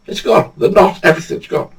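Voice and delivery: It's gone, pitched high in disbelief. Retold or imitated. Angler repeats his loss, high and disbelieving. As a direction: let the word gone jump up, stunned.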